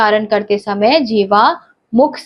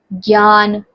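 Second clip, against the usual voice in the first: ordinary talk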